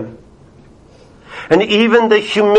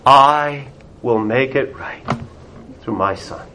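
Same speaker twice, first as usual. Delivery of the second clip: God's reassuring voice; 5 semitones lower, 4 dB quieter than usual. Retold or imitated. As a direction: lower and softer, a calm promise. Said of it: "the will of God looks down on them and says".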